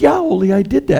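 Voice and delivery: hammed-up chirpy reply; Lena, innocent. Lena's punchline reply.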